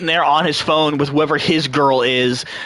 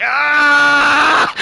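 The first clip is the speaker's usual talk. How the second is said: high, drawn-out mock scream; comic panic scream; 10 semitones higher, 4 dB louder than usual. Passenger caught in his lie, comic panic scream. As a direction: scream in hammed-up terror.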